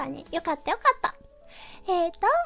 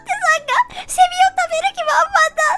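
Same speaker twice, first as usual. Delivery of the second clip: excited; 9 semitones higher, 7 dB louder than usual. Real, not acted. Praising chat eager to eat cicadas.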